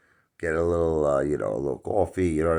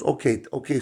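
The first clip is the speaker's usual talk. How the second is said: waitress reply; 10 semitones higher than usual. Retold or imitated. Waitress humoring the customer.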